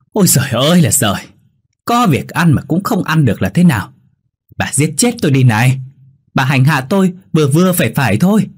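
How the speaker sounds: high-pitched, exasperated narrated scolding; shrieking outcry. A narrator's reading. Wife scolds mother-in-law over broken dishes, high-pitched outcry. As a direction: cry out in high, quick exasperation, without full volume. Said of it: "giọng Nhi đã la toáng lên".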